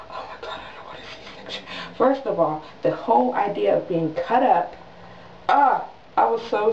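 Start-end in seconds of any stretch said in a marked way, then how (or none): 0.1-1.6 retold frightened whisper to a husband; dread-filled whisper. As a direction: whisper it privately, acting out dread.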